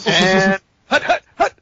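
barking hut, hut, hut; quarterback calling cadence. Acted. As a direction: call the snap like a quarterback. Calling a football snap count.

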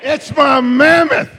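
put-on loud, high, slow New York accent. Preacher joking with congregation, loud New York accent. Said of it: "That was my New York accent".

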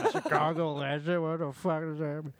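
lightly performed stand-up comic bit; stand-up delivery. Acted. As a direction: toss it out like a stand-up punchline. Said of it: "good impression of you doing stand-up".

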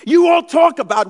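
pastor suddenly booms at the room; loud call out. Real, not acted. Preacher booming at the congregation, loud. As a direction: burst out loud to grab attention.

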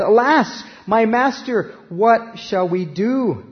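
put-on a panicked young servant crying out to his master; frightened servant's cry. A narrator's reading. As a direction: read it as the boy's alarmed plea. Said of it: "And the servant said".

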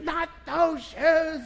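high, slow, exasperated "not those"; wife's impatient correction. Retold or imitated. Wife in labor snapping at husband over shoes, high and slow. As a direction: drag it out, fed up with him.

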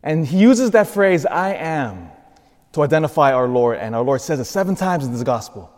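rising, fervent sermon voice; preaching. Real, not acted. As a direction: build the preaching energy. Preaching builds up.

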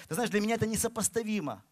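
ordinary talk, nothing marked

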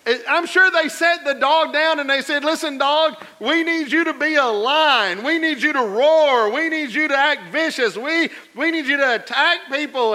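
loud, high, building preacher cadence; full-force preaching. Acted. Pastor acting out zookeepers coaching the dog, loud preaching. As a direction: drive it home to the congregation.

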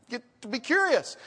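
ordinary talk, nothing marked

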